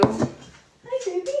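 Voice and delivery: very high cooing hello. Real, not acted. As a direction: greet a pet in a high little voice. Aside greeting to pet.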